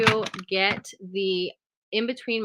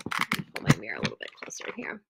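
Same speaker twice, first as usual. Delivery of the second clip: whisper; 10 semitones lower, 6 dB quieter than usual. Real, not acted. Aside while adjusting mirror.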